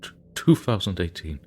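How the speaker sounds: shaken stammer on the date; a touch of a frightened stammer; stammering fear. Acted. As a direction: stutter through it, barely holding on. Terrified man stammering date.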